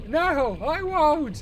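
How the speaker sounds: put-on high, loud, slow shouting old man. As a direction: wail it like a dramatic grandpa. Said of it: "the old man voice".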